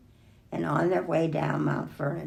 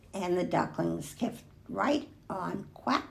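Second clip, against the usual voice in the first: ordinary delivery, nothing marked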